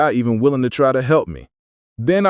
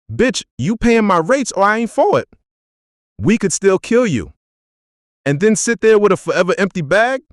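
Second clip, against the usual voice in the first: pushy haggling; 10 semitones higher than usual. Acted. Haggling with minister's side.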